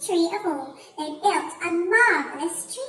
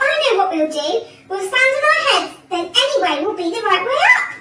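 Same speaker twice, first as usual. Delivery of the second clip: loud, raised eager explanation; excited cry; 5 semitones higher, 7 dB louder, faster than usual. A narrator's reading. Mister Twit proposing they stand on heads, excited cry. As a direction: announce the plan excitedly. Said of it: "cried Mister Twit".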